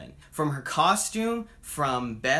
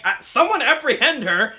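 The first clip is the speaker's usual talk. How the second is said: much higher and louder, an exasperated barked order; pissed commander; 10 semitones higher, 9 dB louder than usual. Retold or imitated. Angry commander orders men to seize Diana, loud exasperated yell. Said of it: "The commander's pissed".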